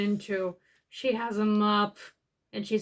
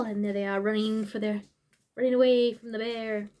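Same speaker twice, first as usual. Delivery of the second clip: ordinary delivery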